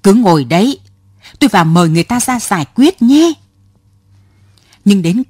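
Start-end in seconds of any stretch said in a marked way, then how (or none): 0.0-3.3 put-on a hurried angry shout at the old woman. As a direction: yell it while storming off. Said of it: "la toáng lên".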